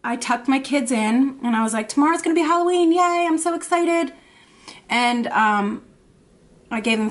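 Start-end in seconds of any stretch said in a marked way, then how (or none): 1.9-4.1 mock excited cheer. Tucking kids in before Halloween.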